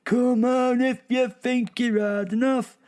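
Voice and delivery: high, loud taunting chirp; cocky bird voice. Acted. Rival bird daring another to fight, high loud taunt. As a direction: squawk a challenge like a tough little bird. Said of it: "can be translated to".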